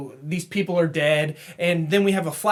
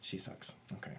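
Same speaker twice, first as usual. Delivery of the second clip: whispered aside; 24 dB quieter than usual. Acted. Jokey echo of cohost's jab.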